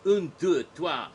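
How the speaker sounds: loud, high French counting; a hammed-up French count. Shown as a demonstration. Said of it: "I like the four-letter four".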